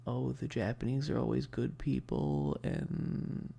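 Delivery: ordinary talk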